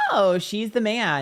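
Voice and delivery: high, loud and slow surprised oh; a real delighted gasp at the card; surprised reveal. Real, not acted. Reader discovering her own movie quote, high and surprised.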